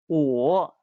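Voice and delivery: 我 dipping low, then rising; third tone. Shown as a demonstration. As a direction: draw out 我, down and back up. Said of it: "in the third tone".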